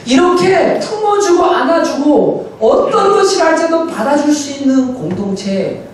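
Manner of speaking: high, insistent sermon delivery; impassioned preaching. Acted. Pastor urging his church to embrace the weak, impassioned preaching.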